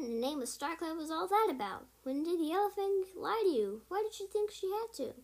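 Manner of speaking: high, loud puzzled questions; baffled and demanding answers; incredulous questions. Acted. Baffled by strange news.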